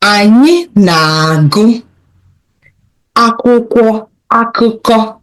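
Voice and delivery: slow teaching read, phrase by phrase; unhurried model sentence for learners; slowly. Shown as a demonstration. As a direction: spell it out slowly for the class. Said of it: "I'll say it slowly".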